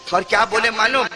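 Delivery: ordinary talk, nothing marked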